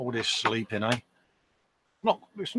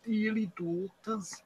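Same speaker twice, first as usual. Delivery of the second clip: mock sleepy voice; 6 semitones higher, 9 dB quieter than usual. Friend echoes the silly voice.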